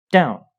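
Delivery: down said with a sharp drop; falling down. Shown as a demonstration.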